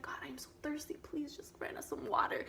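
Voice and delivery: put-on parched child praying quietly; hushed plea. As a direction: beg softly, like a thirsty little boy. Acting thirsty boy's plea to God.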